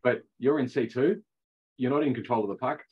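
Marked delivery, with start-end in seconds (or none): none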